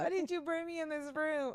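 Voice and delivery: high put-on whiny voice; mock dramatic complaint. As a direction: whine it in jest. Joking voice, then I'm just kidding.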